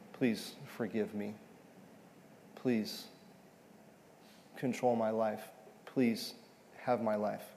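soft, slow pleading prayer; hushed heartfelt prayer of confession; soul-baring plea. Acted. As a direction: lay your soul bare, softly begging God. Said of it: "he's bearing his soul here".

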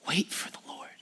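soft breathy whisper of "Wait"; whispered scripture. Real, not acted. Preacher reading the psalm's closing verse to church, whispered. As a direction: hush it like a secret promise.

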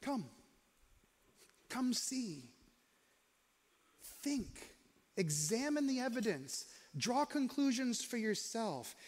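quiet, unhurried welcome; soft coaxing invitation to come and see; gentle, soft invitation. Retold or imitated. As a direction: invite them in softly. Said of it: "What Jesus says is this".

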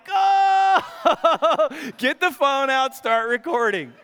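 high, loud gleeful shout; thrilled, calling for the camera; laughing dad shouting get the phone out; excited gleeful call. Retold or imitated.

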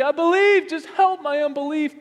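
high, loud desperate cry; father crying out to Jesus. Retold or imitated. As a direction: call out I believe in desperation. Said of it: "the father crying out immediately".

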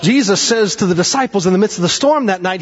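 ordinary talk

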